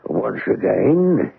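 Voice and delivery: a touch of a cringing seaman's voice; cringing old sailor. Acted. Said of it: "a cringing manner".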